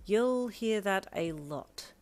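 slow, dry delivery; drawn-out, unimpressed aside; deadpan. Acted. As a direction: say it slowly, resigned. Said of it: "you deadpanned".

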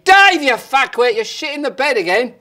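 furious shout. Acted. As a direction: yell at the husband who wet the bed. Wife yelling at husband in bed.

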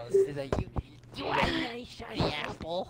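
offering the apple like a witch. Pretend. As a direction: tempt with a hint of the evil queen. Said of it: "bitch from ... Sleeping Beauty".

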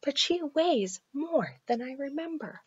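soft, hushed father; whispering. A narrator's reading. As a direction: keep it down near sleeper. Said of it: "he whispered to his children".

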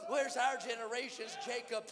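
full-voiced preacher's shout; ringing shout in a revival roll call; shouted cry. Real, not acted. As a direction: shout the name to the back row. Climactic roll call of heroes.

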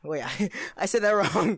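laughing through the correction; amused, words broken by laughter; laughing at own slip. Real, not acted. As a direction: break into a laugh while admitting the slip. Laughs at own slip mid trash talk.

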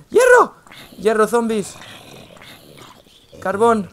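loud, thrilled callouts of found ore; excited shouting. Real, not acted. Finds ores while mining.

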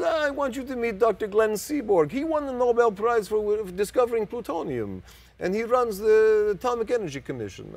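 put-on high, loud Russian-accented introduction; thick Russian accent. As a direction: imitate a proud, booming Russian-accented host. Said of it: "very thick Russian type accent".

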